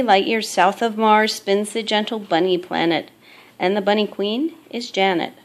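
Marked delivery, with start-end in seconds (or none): none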